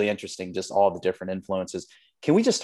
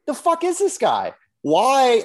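high-pitched, incredulous comic outrage; upset; 13 semitones higher, 5 dB louder, slower than usual. Real, not acted. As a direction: rant in exasperated disbelief, pitch shooting up. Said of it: "I don't know why I'm so upset".

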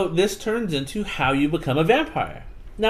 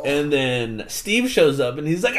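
ordinary delivery

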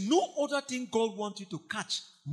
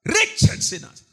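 cried out; 6 dB louder, slower than usual. Shown as a demonstration.

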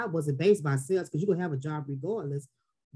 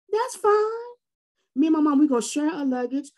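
breezy; 8 semitones higher, 4 dB louder than usual. Real, not acted. Breezy about paying for luggage.

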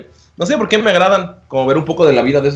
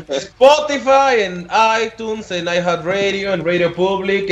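enumeración alargada; 5 semitones higher, much slower than usual. Pretend. Listing platforms in stretched voice.

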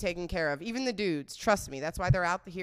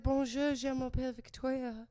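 higher-pitched put-on French accent; beginner's French; 7 semitones higher, 4 dB quieter than usual. Showing her date her little bit of French, put-on French accent. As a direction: chirp a French introduction, pitched up. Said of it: "I knew a little bit of French".

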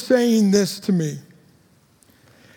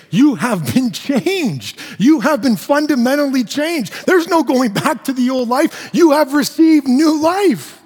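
rising, louder, higher preaching build; preaching at full force; 5 semitones higher, 4 dB louder than usual. Acted. Preacher telling the congregation they are changed, full-force preaching. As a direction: climb to a fervent pulpit peak.

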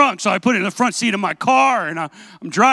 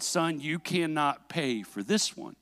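slow, soft, lowered father voice; solemn forgiveness; 4 semitones lower, 10 dB quieter, slower than usual. Retold or imitated. Dad refusing his son's offer to pay, solemn and quiet. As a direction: weigh each word quietly, as Dad.

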